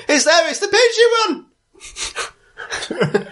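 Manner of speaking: very high-pitched comic cry of alarm; panicked crowd shriek. Acted. Voice in the frightened crowd spotting the party, high shriek.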